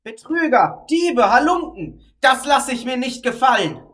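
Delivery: loud, angry ranting; loud scolding. Acted. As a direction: rail at the thieves, outraged. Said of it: "laut schimpfend".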